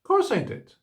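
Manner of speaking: put-on high, frank child's voice, no guilt at all; a cheeky boy admitting it outright; frank little boy. A narrator's reading. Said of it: "said Davy frankly".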